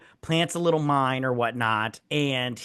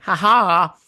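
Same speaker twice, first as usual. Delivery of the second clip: loud, higher taunting ha ha; mock laugh; 4 semitones higher, 8 dB louder than usual. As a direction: gloat with a fake laugh. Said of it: "I wish he would have just been like".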